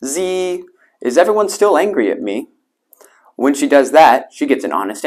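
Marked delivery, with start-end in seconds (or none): 0.0-2.5 whining mosquito. A narrator's reading. The guilty mosquito asks in people's ears, whining. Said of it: "she goes about whining in people's ears".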